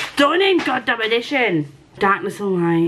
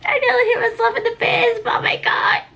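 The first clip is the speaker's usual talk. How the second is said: high, giddy squeal. Real, not acted. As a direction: let the excitement burst out. Overwhelmed by the signature.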